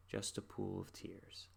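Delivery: slow, soft Just a pool of tears; soft sad line. Retold or imitated. Reciting the song's sad ending, slow and soft. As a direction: let the sorrow sink, slowly and softly. Sad lyric repeated, fading.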